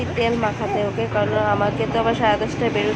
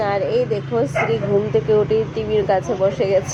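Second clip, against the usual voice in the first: usual speaking voice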